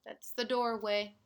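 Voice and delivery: ordinary talk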